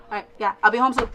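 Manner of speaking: ordinary delivery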